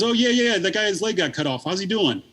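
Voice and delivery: a light mock of a cheerful neighbour; imitating Pugs. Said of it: "He goes".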